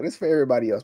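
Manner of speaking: ordinary delivery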